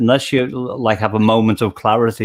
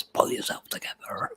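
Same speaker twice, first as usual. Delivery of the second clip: unvoiced, breathy whisper of the punchline; whispered joke punchline; 12 dB quieter than usual. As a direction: hush it out like a secret.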